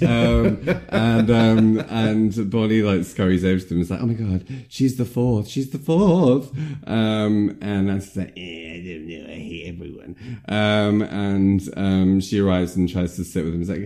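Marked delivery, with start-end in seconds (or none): 4.0-6.5 higher voice, building on the repeat; mock excited. Friend retelling Bonnie's discovery to the coven, mock excited. 8.4-10.2 very soft, grumbled mock moody teen; sullen mumble. Friend voicing Nancy dismissing the newcomer, mock sullen mumble.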